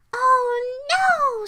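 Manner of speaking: high, loud, drawn-out moan; moaned dismay. A narrator's reading. Goldilocks sinking into Mama Bear's bed, moaning. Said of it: "she moaned as she sank".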